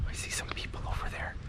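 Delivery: hushed whisper so the people won't hear; real whisper. Real, not acted. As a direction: whisper it under your breath. Spots people, drops to whisper.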